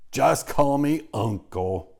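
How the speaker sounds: slow, loud and booming; a bluff jolly pig, slow and loud; big hearty voice. A narrator's reading. Pig booms his name at the spider, slow and loud. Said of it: "he said in a big, hearty voice".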